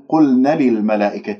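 "قلنا للملائكة" with the نا cut short to a bare na; short vowel linking "قلنا" straight into "للملائكة"; missing natural madd. Shown as a demonstration. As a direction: demonstrate the mistake: no lengthening on "قلنا". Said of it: "إذا ما مديت طبيعي".

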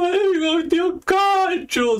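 very high, slow "¡Ay, tío Cacho!", hammed-up wailing; imitating whiny sister. Mocking his sister wailing to their mom about Cacho, a high whiny wail. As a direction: squeal it like a bratty little sister complaining. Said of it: "mi hermana gritando y llorando".

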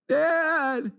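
put-on high, drawn-out child's whine; whiny high call for a parent; little boy's cry voice. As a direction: imitate a tearful kid calling from upstairs. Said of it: "I hear the cry voice from upstairs".

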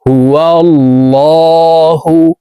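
"হুয়াল্লাহু" with thick, dark l and held vowel; heavy lam. Shown as a demonstration. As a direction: chant "হুয়াল্লাহু" slowly, l made heavy. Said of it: "মোটাভাবে এই লামকে পড়তে হবে".

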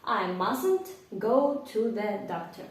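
slow model sentence. Shown as a demonstration. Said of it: "проговоріть вголос".

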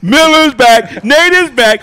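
high, loud, drawn-out hype; hyped-up shouting. Pretend. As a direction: holler it to fire up the show. Hyped shouting about being back.

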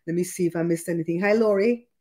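ordinary talk

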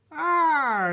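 a high, loud little puppy voice; voicing the puppy. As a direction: speak for the dog with a squeaky cry. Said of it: "he says".

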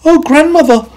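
put-on very high, loud girl voice; high girl, slowing on the big teeth; little girl amazed.

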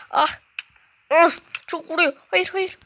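high, loud yelps as the hits land; pained panic. Real, not acted. Gamer yelps in panic while a zombie hits. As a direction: cry out under attack.

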